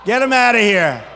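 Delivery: loud barking commands at security; loud demand to security to hurry; shouted order. Real, not acted. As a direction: shout it across the hall. Ordering protesters removed.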